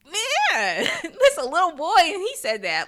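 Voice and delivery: high, loud, laughing disbelief; laughing amazement. Real, not acted. Marveling at what little Ethan said, laughing amazement.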